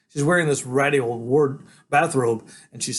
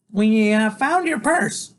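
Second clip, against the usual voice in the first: put-on high, loud, hesitant kid's voice; blurted out; 10 semitones higher, 6 dB louder, slower than usual. A narrator's reading. Freak blurts out about the found purse, high and nervous. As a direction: blurt it out high after a stumble. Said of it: "Freak blurts out".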